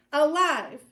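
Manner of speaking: loud, punchy call to repeat; called-out demonstration. Shown as a demonstration. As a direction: call the word out for the group. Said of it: "We're gonna say".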